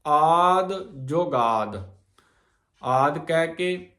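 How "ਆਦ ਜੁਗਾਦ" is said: slow, with a rest after ਜੁਗਾਦ; pause after ਜੁਗਾਦ. Shown as a demonstration. Teacher shows the congregation where to pause. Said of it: "ਜੁਗਾਦ ਇੱਥੇ ਵਿਸਰਾਮ ਦੇਣਾ ਆ".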